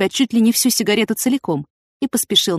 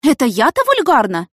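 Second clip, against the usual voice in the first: higher, louder offended question; indignant exclamation; 7 semitones higher, 5 dB louder than usual. A narrator's reading. Yana answering the charge of vulgar dress, indignant. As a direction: throw the accusation back in disbelief. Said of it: "воскликнула Цветкова".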